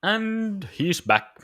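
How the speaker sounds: high, slow showman announcement; announcer voice. Acted. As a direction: announce your return like a host. Announces own return to stream.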